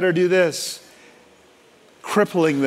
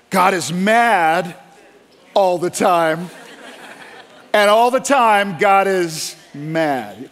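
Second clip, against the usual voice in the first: slow, drawn-out mock sermon line; mocking angry preacher; 2 semitones higher, much slower than usual. Preacher parodied by pastor, slow mock-solemn drawl. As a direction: parody a harsh preacher's refrain. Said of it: "the preacher got up and he said".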